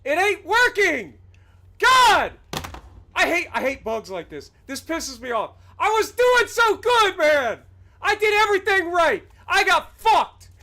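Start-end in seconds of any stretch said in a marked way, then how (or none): 0.1-3.5 slow, loud frustrated shout ending in a yelled God; venting real rage at the game; angry outburst. Real, not acted. Streamer to chat as the game bugs out, loud angry yelling. 5.8-10.5 furious shout. Real, not acted. Streamer venting to chat after a bugged landing, furious shouting.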